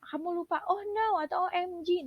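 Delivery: high, loud bursts of alarm; excited exclamations. Shown as a demonstration. Teacher acts out interjections for the class, excited. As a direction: throw out each cry with strong feeling. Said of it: "Atau kalau kalian bilang".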